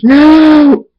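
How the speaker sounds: long, high screamed no; loud, drawn-out high cry. A narrator's reading. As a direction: scream it and hold the vowel. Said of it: "Schooler screams".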